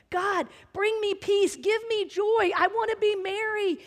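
pleading prayer. Retold or imitated. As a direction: plead with God for joy. Begging God in prayer.

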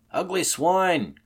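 high, loud, drawn-out shout; alarmed cry. Acted. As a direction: cry it out slowly and loudly in disgust. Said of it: "cried one of the men".

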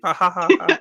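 high, loud mock cackle; sarcastic fake laugh. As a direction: play it as a manic sarcastic answer. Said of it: "mock reply as Chelsea".

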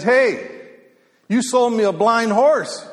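loud, high mock outrage; slow, indignant retold complaint; mad. Cheated rancher confronting the horse seller, mad. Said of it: "He's so mad".

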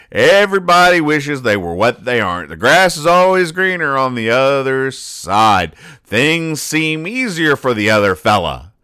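worked-up commentary; heated rant. Real, not acted. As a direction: build into a fired-up explanation. Ranting about grass is greener.

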